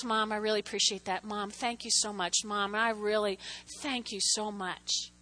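soft, low, grateful voice; heartfelt thanks. Retold or imitated. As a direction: thank your mother quietly and sincerely. Acts her child's heartfelt thanks.

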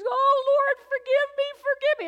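loud, high wailing plea, acted out; weeping plea. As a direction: wail it like a desperate, hammed-up penitent. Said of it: "she's weeping".

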